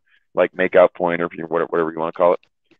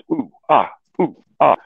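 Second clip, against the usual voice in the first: a hammed-up pair of ooh-ah moans, higher; mock moaning; 6 semitones higher than usual. Mimicking the couple on the beach with mock moans. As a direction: tease the lovers with mock moans. Imitating nearby couple making out.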